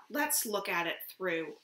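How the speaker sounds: usual speaking voice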